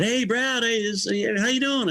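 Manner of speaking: high-pitched, hearty hello; upbeat greeting. Retold or imitated. Road manager welcoming the new guitarist at the party door, upbeat. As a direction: greet him brightly, pitch lifted well up.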